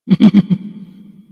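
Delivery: short burst of loud laughter; a quick amused laugh; real laugh. Real, not acted. Laughs after checking live viewers.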